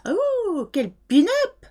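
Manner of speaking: high French exclamation, imitating admiring relatives; impersonated French family, swooping up in admiration; French accent. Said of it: "French accent, like".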